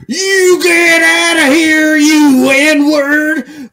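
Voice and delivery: very high, loud, slow mock scream; yelling. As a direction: scream it after a passer-by. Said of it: "points at him and yells".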